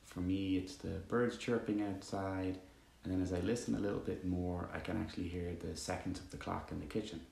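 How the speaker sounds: calm, even meditation voice with long pauses; guided meditation. Acted. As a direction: guide listeners gently into stillness, leaving long silences.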